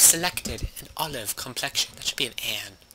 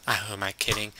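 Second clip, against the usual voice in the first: normal talking voice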